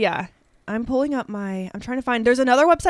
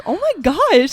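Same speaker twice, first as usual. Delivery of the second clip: excited; 6 semitones higher, 6 dB louder, slower than usual. Retold or imitated. Excited reaction retold.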